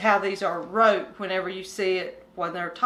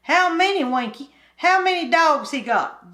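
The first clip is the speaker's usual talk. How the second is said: higher, louder eager questions; eager country boy; 6 semitones higher, 5 dB louder than usual. Acted. Kim asking Winky about the coon dogs, eager and higher. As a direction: press for the answer eagerly.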